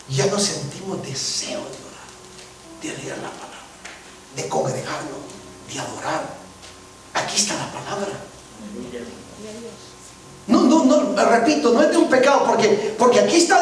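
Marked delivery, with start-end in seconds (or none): 0.1-8.1 susurro dramático. Acted. Predicador baja la voz al advertir.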